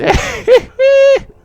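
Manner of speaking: very high, loud cackle; old crone's cackle. Acted. Old seer finishes her doom prophecy, loud high cackle. Seer laughs at world's end.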